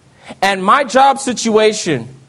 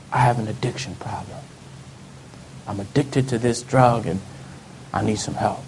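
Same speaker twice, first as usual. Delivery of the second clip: hushed confession; 10 semitones lower, 10 dB quieter than usual. Retold or imitated. Confessing addiction to HR manager.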